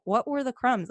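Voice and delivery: usual speaking voice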